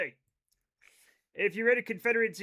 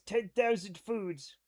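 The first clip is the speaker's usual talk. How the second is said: ordinary delivery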